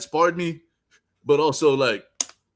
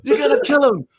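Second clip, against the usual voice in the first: higher, louder egging-on yell; mock urging; 11 semitones higher, 7 dB louder than usual. Retelling the sparring match, a loud egging-on yell. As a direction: egg him on hard.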